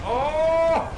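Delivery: sudden high loud cry of surprise; startled cry. Real, not acted. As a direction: yelp as the shot lands. Tense moment, relief.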